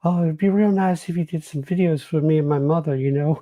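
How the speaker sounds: high, wheedling put-on voice; impression of a caller. Caller asks George for videos, high put-on voice. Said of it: "that's, like, my impression of him".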